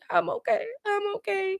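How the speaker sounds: very high, thin mock-tearful whimper; mock crying. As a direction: imitate someone sobbing that she's fine. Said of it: "start crying, hyperventilating".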